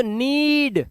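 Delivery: नीड with the ee held long; slow, drawn-out नीड; long-vowel demo. Shown as a demonstration. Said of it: "अब कैसे पढ़ेंगे".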